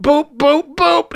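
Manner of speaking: high electronic boops; mocking bomb-timer imitation; mimicked countdown beeps. A narrator's reading. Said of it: "mimicking the countdown tone".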